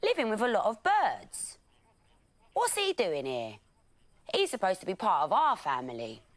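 lion gang voice. A narrator's reading. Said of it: "said one of the lions".

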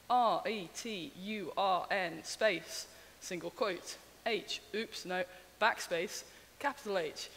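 slow, letter-by-letter dictation; dictating. Shown as a demonstration. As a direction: spell out each character slowly. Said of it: "you'll say your solution".

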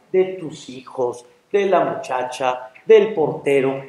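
low, soft, unhurried listing; Angel of Death. Acted. Angel telling the man his complaints were heard, calm. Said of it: "sale una voz".

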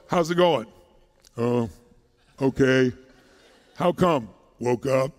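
low, listless monotone; glum low-energy person. Acted. Mocking people who feel like crap for no reason, glum monotone. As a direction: drop it down like someone with no energy.

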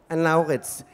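usual speaking voice

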